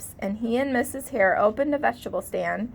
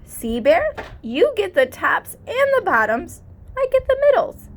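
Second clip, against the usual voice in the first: rising higher and louder to a triumphant high finish; gleeful trickster; 8 semitones higher, 6 dB louder than usual. A narrator's reading. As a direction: crow over the clever trick. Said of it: "Bear rubbed his eyes and watched".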